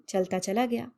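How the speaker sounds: ordinary delivery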